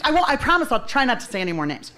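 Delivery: ordinary talk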